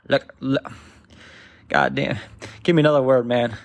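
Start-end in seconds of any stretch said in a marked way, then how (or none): none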